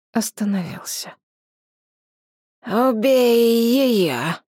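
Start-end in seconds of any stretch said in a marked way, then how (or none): 2.7-4.3 menacing dragon voice. A narrator's reading. Dragon orders a killing.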